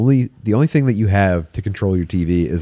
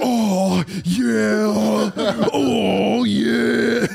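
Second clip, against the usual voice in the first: mock porn moaning; 12 semitones higher, much slower than usual. Imitating the blasting hotel TV porn for friends, hammed-up moaning. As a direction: ham up the fake porn moans.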